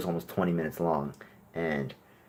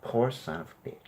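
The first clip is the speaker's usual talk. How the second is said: mock pity; 4 semitones higher, 7 dB quieter than usual. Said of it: "would you rather me say".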